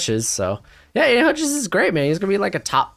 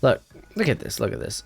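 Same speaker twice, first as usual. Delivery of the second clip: normal talking voice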